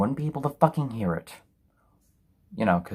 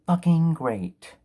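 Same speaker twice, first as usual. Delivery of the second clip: slow, heavy sarcasm, higher; sarcastic drawl; 6 semitones higher, 3 dB louder, much slower than usual. Real, not acted. Reviewer drags out sarcastic praise for the film. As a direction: drag each word out in disgust.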